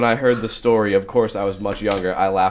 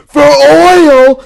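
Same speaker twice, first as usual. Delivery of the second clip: screamed much higher and louder; 17 dB louder than usual. Retold or imitated. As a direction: scream it out in outrage. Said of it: "'cause he screamed it".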